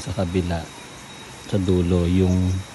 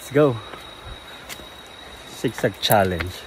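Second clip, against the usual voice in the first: ordinary talk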